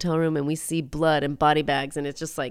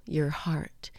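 hushed deadly reply; 2 semitones lower, 7 dB quieter than usual. Retold or imitated. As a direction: whisper the correction to the dying friend. Needy's deadly reply, whispered.

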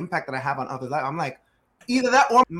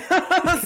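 laugh; 7 dB louder than usual. Real, not acted. Laughing at own impression.

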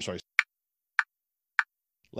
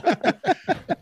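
squealing laugh, much higher. Real, not acted. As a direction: burst into a high giggle. Wrapping up show, high laugh.

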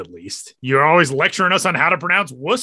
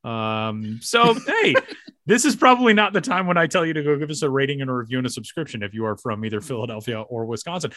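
ordinary talk, nothing marked

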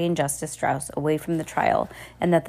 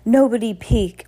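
louder, raised-pitch command; firm order; 5 semitones higher, 5 dB louder than usual. A narrator's reading. Bearded man orders the crowd, firm loud command. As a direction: order the whole room firmly. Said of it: "ordered the man with the beard".

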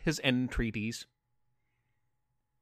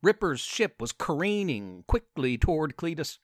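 plain reading voice

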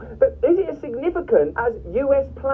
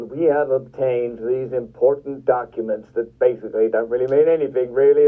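low mock-solemn anchor voice; self-important news anchor; 8 semitones lower than usual. News anchor presenting obtained documents to viewers, mock self-important. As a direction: send up a pompous newsreader, pitched well down. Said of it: "trying to make itself sound super important".

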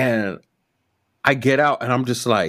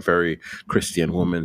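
ordinary talk

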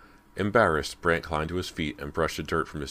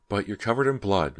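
protesting; 5 semitones higher, 4 dB louder than usual. A narrator's reading. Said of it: "Missus McCloy protested".

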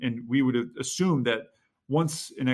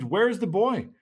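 high-pitched mild exclamation; exclaimed question; 8 semitones higher, 4 dB louder than usual. A narrator's reading. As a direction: read it as the marshal's exclaimed question. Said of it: "He exclaimed".

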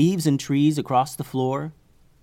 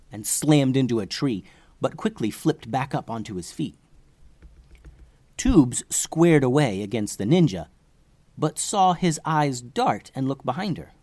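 ordinary delivery, nothing marked

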